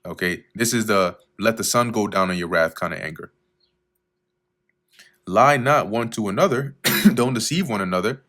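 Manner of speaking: ordinary delivery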